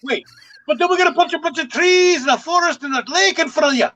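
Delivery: put-on high, lively Scottish accent. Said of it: "he does it in the Scottish accent".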